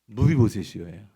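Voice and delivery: a pitying, loving call of Mephibosheth's name; tender calling of the name. Shown as a demonstration. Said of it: "감정이 실린 그 한마디가 뭐였냐면".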